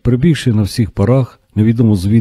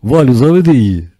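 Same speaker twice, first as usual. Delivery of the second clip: shouting; 7 semitones higher than usual. A narrator's reading. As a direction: shout it out patiently to a deaf granny. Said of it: "закричав Тарас".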